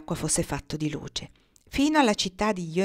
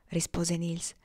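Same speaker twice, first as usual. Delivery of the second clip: plain reading voice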